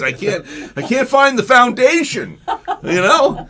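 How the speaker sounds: high, loud comic panic; mock scared. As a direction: wail it in hammed-up fright. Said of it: "I'm scared".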